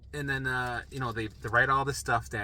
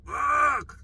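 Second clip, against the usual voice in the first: high, loud groaned curse; mock-agonised outburst; exasperated cry; 6 dB louder than usual. Man forced to watch his life review, exasperated cry.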